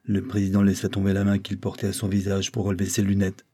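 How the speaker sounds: ordinary delivery, nothing marked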